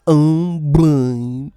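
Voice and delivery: loud, slow mumbled cartoon voice; mock them like a droopy cartoon dog; hammed-up mumbling cartoon imitation; Droopy Dog mockery. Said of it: "They sound like that".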